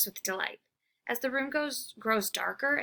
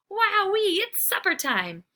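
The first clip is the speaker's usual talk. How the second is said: excited; 11 semitones higher, 5 dB louder than usual. Acted. Excited dog sees food.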